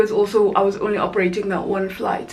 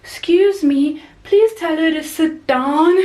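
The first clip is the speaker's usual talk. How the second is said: put-on higher, polite little-kid voice, a bit slow; little boy voice; 8 semitones higher, 4 dB louder, slower than usual. Boy imitated asking the crew member about his sister, in a high polite kid voice. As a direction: imitate a prim six-year-old asking politely. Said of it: "he was like".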